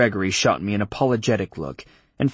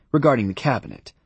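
ordinary delivery

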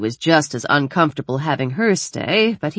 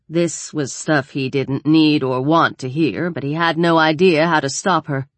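plain reading voice

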